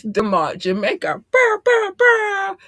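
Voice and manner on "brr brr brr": high, loud "brr" bursts; celebratory air-horn trill. Real, not acted. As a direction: blast out a hype horn noise. Celebratory noise after naming Jamaica.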